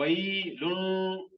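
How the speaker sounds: वैलुन slowed, with the noon hummed through the nose; nasal ghunna. Shown as a demonstration. Teacher demonstrates the right ghunna on वैलुन, slow and nasal. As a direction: draw out वैलुन with a nasal hum. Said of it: "इसको हमने गुन्ना करना है".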